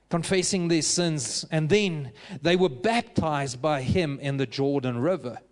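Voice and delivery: ordinary talk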